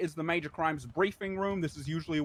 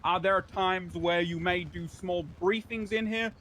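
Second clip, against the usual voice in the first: ordinary talk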